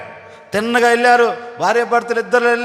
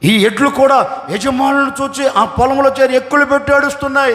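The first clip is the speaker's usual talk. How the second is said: normal talking voice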